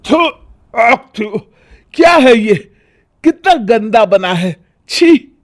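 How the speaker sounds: spitting out food in disgust; yuck, this is awful. Acted. Husband tasting bad chowmein, disgusted.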